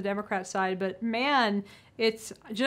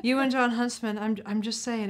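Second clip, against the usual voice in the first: ordinary delivery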